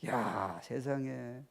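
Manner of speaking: ordinary talk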